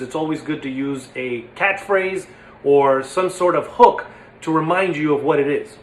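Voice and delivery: ordinary delivery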